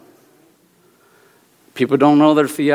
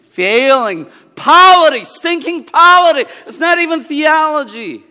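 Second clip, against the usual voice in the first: mock outrage; 11 semitones higher, 7 dB louder than usual. Ranting about failing polity.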